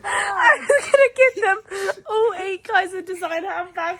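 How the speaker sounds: high, slow words through loud laughter; laughing hard. Real, not acted. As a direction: push it out while crying with laughter. Laughing retelling, high pitch.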